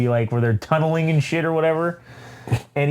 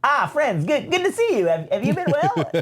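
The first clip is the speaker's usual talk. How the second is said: much higher, sweetly sinister droid greeting; Triple Zero voice; 11 semitones higher than usual. Retold or imitated. Triple Zero greets the crew in a high, sweetly sinister voice. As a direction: welcome them like a creepy butler. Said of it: "He says".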